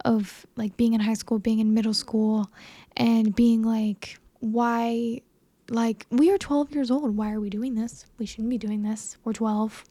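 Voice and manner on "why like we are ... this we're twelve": ordinary talk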